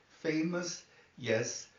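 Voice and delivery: usual speaking voice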